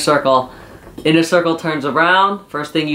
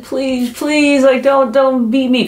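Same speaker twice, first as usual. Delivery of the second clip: much higher whimpering mock begging; mock crying plea; 10 semitones higher, slower than usual. MJF on the ground begging Jericho, in a mock tearful plea. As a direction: beg like a kid about to cry, hammed up. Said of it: "like, almost crying".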